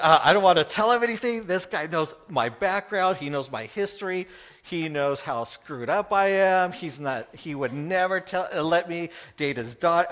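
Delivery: rushed, loud worried thoughts; panicked inner rant. Retold or imitated. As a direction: spill out the panic in his head. Voices his own panicked thoughts.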